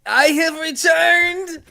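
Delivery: slow, loud, booming return; triumphant villain. Acted. Sorcerer announcing his return, slow booming proclamation. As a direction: proclaim it like a reborn dark lord.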